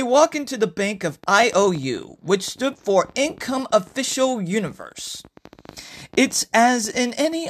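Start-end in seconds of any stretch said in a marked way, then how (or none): none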